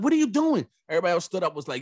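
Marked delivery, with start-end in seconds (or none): 0.0-0.7 high, loud "what are you doing"; outraged parent. Retold or imitated. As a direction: call him out sharply. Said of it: "the parent was like".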